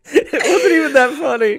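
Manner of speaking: high, loud, still laughing. Real, not acted. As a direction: squeeze words through laughter. Laughing fit after joke.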